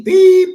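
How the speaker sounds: high, loud held beep; censor beep. Retold or imitated. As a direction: sing out a censor tone over the swear word. Imitates a censor beep over swearing.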